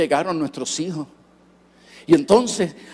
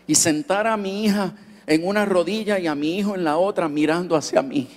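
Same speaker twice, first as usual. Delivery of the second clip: ordinary talk, nothing marked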